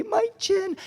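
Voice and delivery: put-on squeaky-high tearful little kid; crying child. Hurt son showing dad the injury, crying. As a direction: whimper it like a hurt toddler, mock crying. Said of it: "just started crying".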